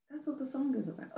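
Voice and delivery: a quick teary laugh breaking over the words; laughing through tears. Real, not acted. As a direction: let the laugh and the tears collide. Emotional after sharing song.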